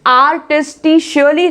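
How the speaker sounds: आर्टिस्टिशियली with "sho-lee" for the ending; wrong pronunciation demo. Shown as a demonstration. Teacher showing a mistake to avoid, mispronounced. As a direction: swap the k sound for "sh" in आर्टिस्टिशियली. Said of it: "नहीं बोलेंगे".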